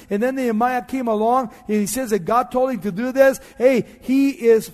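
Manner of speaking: preacher building to a peak; forceful preaching. Acted. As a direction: press the congregation with mounting volume.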